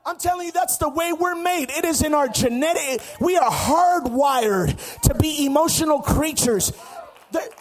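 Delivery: driving, rhythmic sermon shout; full-force preaching. Real, not acted. Preacher telling the church shouting is human, full-force preaching. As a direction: pile up the phrases in a hard preaching cadence.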